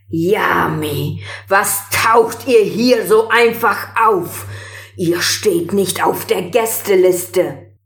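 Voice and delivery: indignant emperor, tension rising; barely contained anger. A narrator's reading. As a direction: challenge the intruder, close to exploding. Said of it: "kurz vor einem Wutausbruch".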